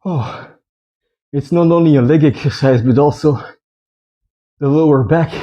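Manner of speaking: panting between words; winded after a hard set; out of breath. Real, not acted. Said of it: "let me catch my breath".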